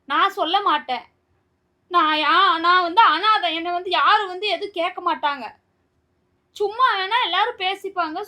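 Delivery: loud, upset and wounded, insisting she is alone; wife raising her voice at her husband in real hurt; hurt angry outburst. Real, not acted. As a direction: shout out the hurt of being left alone. Wife upset, loudly feeling alone.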